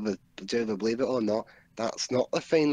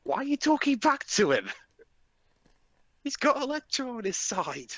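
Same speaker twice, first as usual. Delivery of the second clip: mocking scold; 10 semitones higher than usual. Mocking character on screen.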